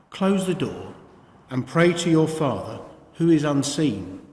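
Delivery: ordinary delivery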